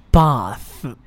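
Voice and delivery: bath with the th bitten, tongue out; tongue between teeth. Shown as a demonstration. Teacher shows the th sound in bath. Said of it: "是一个咬舌的音".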